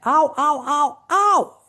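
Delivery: high, loud mock yelps of pain; hammed-up ouch cries. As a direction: yelp it like a sharp sting. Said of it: "one of the words you'd say".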